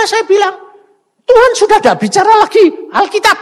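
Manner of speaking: loud, high, driving sermon voice; preacher raising his voice to the congregation; fiery preaching. Acted. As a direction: preach it at full force, voice soaring. Preaching loudly with quoted voices.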